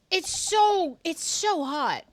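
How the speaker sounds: high, loud, drawn-out swoon; gushing. Real, not acted. Streamer swooning over Scottish accent, high gushing. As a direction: gush with delight.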